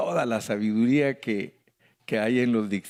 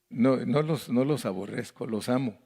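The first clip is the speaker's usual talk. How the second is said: ordinary delivery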